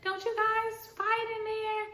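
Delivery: very high, singsong upbeat hall leader; perky hall leader. Retold or imitated. Hall leader knocking on the dorm door, very high singsong voice. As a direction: chirp it like a cheerful upperclassman. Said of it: "She's like".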